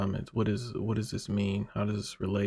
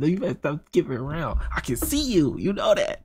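playful mock warning, pitched higher, teasing viewers who skip; mock threat; 5 semitones higher than usual. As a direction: pretend to scold the viewer, tongue in cheek. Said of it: "No, I'm just kidding".

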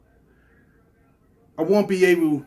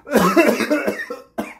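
loud real cough, higher; coughing fit; 9 semitones higher, 5 dB louder than usual. Real, not acted. Streamer after hurting his throat with a noise, coughing loudly. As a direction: hack to clear a hurting throat. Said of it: "that hurt".

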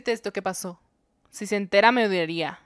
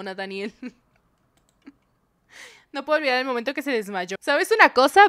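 ordinary talk

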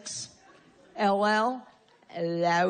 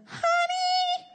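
whiny wife; 6 semitones higher, slower than usual. Retold or imitated. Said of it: "has ever said to your husband".

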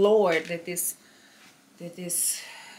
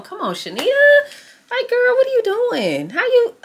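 exasperated self-scolding; 6 dB louder than usual. Real, not acted. Venting at herself after the sound failed, exasperated. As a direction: scold yourself in disbelief, then sigh it off.

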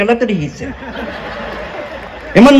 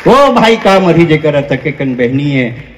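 full-voiced, low recitation; low-pitched line at full volume; punchline repeated; 4 semitones lower than usual. Real, not acted. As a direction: repeat it firmly to the whole crowd.

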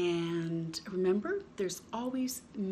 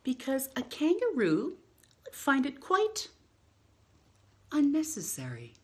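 lively picture-book read-aloud with long pauses; reading to children; slower than usual. Acted. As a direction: read it like story time for little ones.

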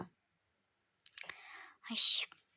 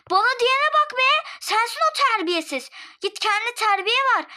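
high, loud snap back; angry retort; 10 semitones higher, 4 dB louder than usual. Acted. Neighbour snaps back at being called rude, angry. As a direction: hit back hard at the insult.

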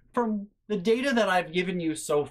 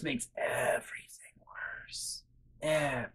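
whisper; 9 dB quieter than usual. Real, not acted. Aside about the dog's fate.

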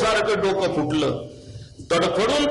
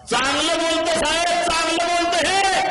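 indignant shout; 11 semitones higher than usual. Acted. Woman protests to the judge.

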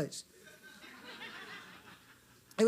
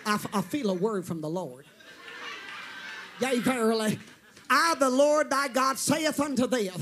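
Pentecostal preacher voice; 2 semitones lower than usual. Acted. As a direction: intone it like an old-time Pentecostal prophet, hammed up. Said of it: "how to do it Pentecostal-like".

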